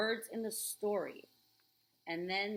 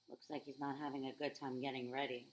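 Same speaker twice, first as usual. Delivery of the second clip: usual speaking voice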